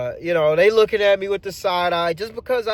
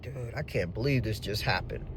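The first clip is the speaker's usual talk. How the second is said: lower, softer disbelief; stunned mutter; 9 semitones lower, 12 dB quieter than usual. Retold or imitated. Driver alone at home, stunned soft murmur.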